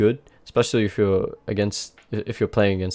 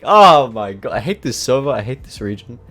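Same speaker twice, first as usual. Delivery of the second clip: salty rant; 3 semitones higher, 6 dB louder than usual. Real, not acted. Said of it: "Sorry, I'm a bit salty".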